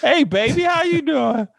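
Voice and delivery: high, put-on flirty voice; a customer hitting on the cashier; customer flirting. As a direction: flirt through the drive-thru speaker. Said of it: "I'm like".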